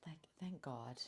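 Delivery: soft whispered thanks; whispered relief at last; relieved whisper. Real, not acted. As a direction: breathe it out in relief. Relief during transition.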